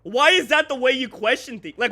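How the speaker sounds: normal talking voice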